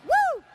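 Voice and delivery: loud, high "woo"; short high-pitched whoop. Acted. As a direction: whoop like you've been bounced up. Reacts to the man landing.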